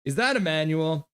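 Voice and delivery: ordinary talk, nothing marked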